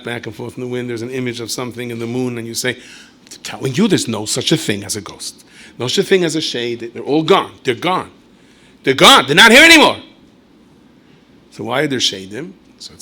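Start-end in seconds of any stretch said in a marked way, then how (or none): 2.8-10.0 skeptic insisting. Acted. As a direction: hammer it home louder and louder, no ghosts. Voicing a skeptic denying ghosts.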